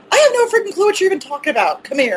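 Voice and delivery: high, fast, fed-up outburst; exasperated. Retold or imitated. Wife telling husband she has no clue, high and fast. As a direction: throw up your hands at the husband. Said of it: "I'm like".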